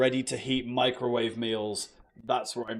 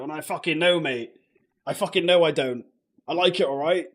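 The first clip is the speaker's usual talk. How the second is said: annoyed outburst; 4 semitones higher, 8 dB louder than usual. Real, not acted. Angry reply to troll insult.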